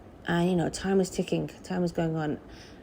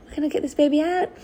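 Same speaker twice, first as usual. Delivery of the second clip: high, thrilled squeal of joy; excited; 9 semitones higher, faster than usual. Retold or imitated. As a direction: gush it out, over the moon. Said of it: "so I got really excited".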